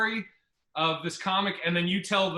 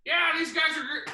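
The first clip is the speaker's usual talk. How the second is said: put-on drunk headliner; 9 semitones higher than usual. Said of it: "So he goes like this".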